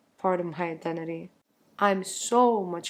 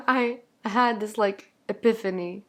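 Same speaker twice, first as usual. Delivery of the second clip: usual speaking voice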